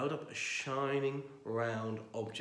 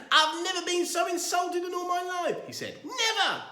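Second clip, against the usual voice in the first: outraged; 8 dB louder than usual. Acted. Outraged exit.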